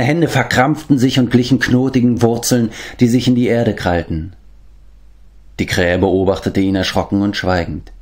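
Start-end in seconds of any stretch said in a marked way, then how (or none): none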